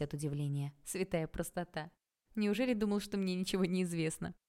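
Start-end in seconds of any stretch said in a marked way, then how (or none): none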